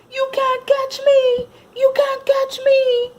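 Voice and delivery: high, loud, slow singsong taunt; teasing shout. Acted. Billy and Sean taunting the puppy, teasing singsong shout. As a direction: dare the puppy to chase you. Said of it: "shouted Billy and Sean".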